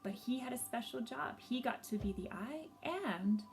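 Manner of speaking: gentle storybook narration with lively swells; reading to children. A narrator's reading. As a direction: tell it like a picture book read to a class.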